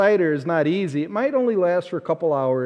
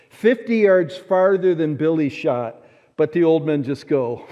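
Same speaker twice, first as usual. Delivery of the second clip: usual speaking voice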